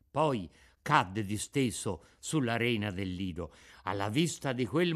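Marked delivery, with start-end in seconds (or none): none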